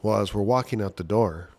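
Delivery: ordinary delivery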